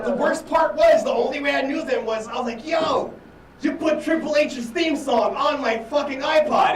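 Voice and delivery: high, loud exasperated shout at a friend; hammed-up outrage, loud and high; mock outraged yell. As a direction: yell it like he wrecked your life. Retold shouted confrontation with friend.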